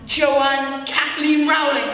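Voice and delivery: loud, slow, every name weighted; slow triumphant naming. Acted. Friend defeats the evil twin by saying her full name loudly. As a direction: spell the full name out like a finishing blow.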